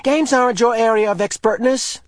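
high, loud indignant voice; angry. A narrator's reading. Asher angrily telling Jonas that games are his area. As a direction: put him in his place. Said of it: "Asher pointed out angrily".

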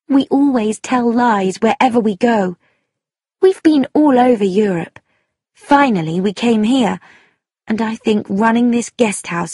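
emphatic, resentful account, lifting in pitch; bitter young woman. Acted. Daughter confessing the family's lies, bitter. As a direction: unload the family secret with bitterness. Sheila tells of their lies.

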